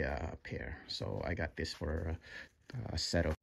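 usual speaking voice

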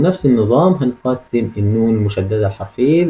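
ordinary talk

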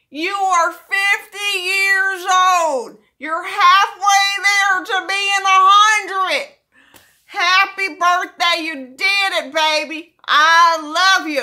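loud, high, slow and sing-song; hammed-up loud greeting. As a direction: belt it out like a stage mom. Hammed-up loud sung birthday greeting.

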